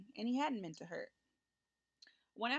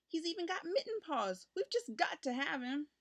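put-on higher eager child's plea; earnest boy; 7 semitones higher than usual. A narrator's reading. Richard earnestly pleads with mother, higher and eager. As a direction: plead earnestly, certain it's right. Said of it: "he said earnestly".

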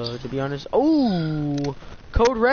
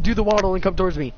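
ordinary talk, nothing marked

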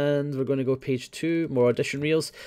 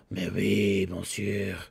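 put-on French accent; 5 semitones lower, 5 dB quieter, much slower than usual. Mock French.

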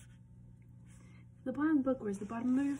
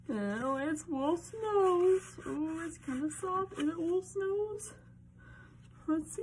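high-pitched pretend discovery; wondering suspense; 7 semitones higher than usual. Reader feels inside the book for Wolf's nose, high wondering voice.

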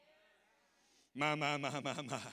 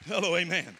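ordinary talk, nothing marked

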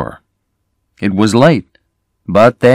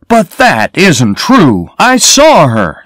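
higher and louder, slow, insistent protest; cried out; 9 semitones higher, 7 dB louder, slower than usual. A narrator's reading. Sam protests to the sheriff, crying out loud. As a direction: refuse to believe it, crying out. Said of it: "Sam cried".

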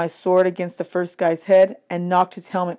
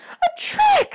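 very high, enraged cry; furious shout. Acted. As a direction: cry out in outrage at being fooled. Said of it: "she shouted".

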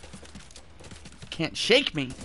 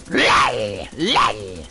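loud, low raspy roar; rough, loud game cry; harsh yell; 6 semitones lower, 6 dB louder than usual. Real, not acted. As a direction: bellow it twice like a beast. Yelling noises in game.

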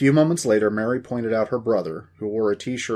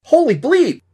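high, loud burst of shock; astonished yelp; startled exclamation; 12 dB louder than usual. Acted. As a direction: blurt it out in amazement. Dusty startled by his photo.

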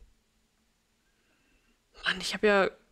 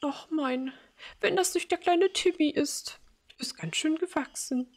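put-on higher, doting old-mother voice; elderly mother voice; 8 semitones higher than usual. Old mother greeting her grown son as little Timmy, doting. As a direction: greet him like a fond, confused old mum.

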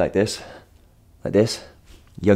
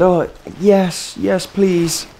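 high-pitched imitation of a young girl; young girl's reply; 12 semitones higher, much slower than usual. Girl accepting her dropped drink back, high imitated voice. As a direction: put on a high, eager little girl's voice. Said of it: "And she said".

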